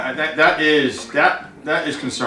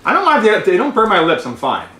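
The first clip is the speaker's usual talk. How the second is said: ordinary talk, nothing marked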